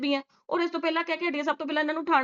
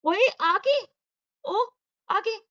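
mocking the wife; 8 semitones higher, much slower than usual. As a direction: toss off her short reply in a higher voice. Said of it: "Dev ਜੀ ਕਹਿੰਦੀ ਹੁੰਦੀ ਆ".

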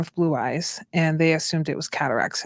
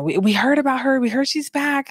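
high-pitched gossipy nurse imitation; higher, retold excited chatter; imitated excited nurses; 8 semitones higher than usual. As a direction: mimic nurses eager to see the baby. Voicing nurses who came to see baby.

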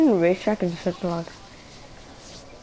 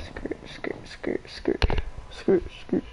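whispered skrrt; 11 semitones higher, 5 dB quieter, slower than usual. Pretend. Whispered skid noise.

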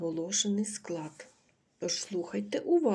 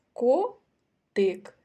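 Котик louder and higher, the first syllable КО stretched; stress on КОтик; 4 semitones higher, 7 dB louder than usual. Shown as a demonstration. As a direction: lean on КО in Котик. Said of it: "Який склад наголошений?".

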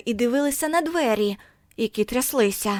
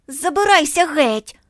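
slow, loud, higher bullying order; angry shout; 6 semitones higher, 6 dB louder than usual. A narrator's reading. Bully driving Margo off, loud slow shout. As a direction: bark her away. Boy shoves Margo away.

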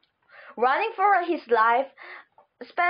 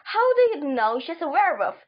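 plain reading voice

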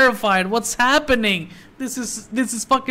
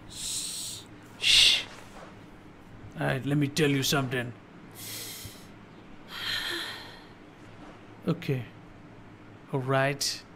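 much softer and lower, nearly whispered; hushed; 7 semitones lower, 13 dB quieter, slower than usual. Real, not acted. Player hushing himself during a tense moment, quietly. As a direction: hush down so you don't disturb the scene. Hushing while game character talks.

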